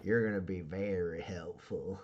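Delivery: quiet, low boss voice; lightly voiced tough boss, pleased; boss approving. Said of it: "and she goes".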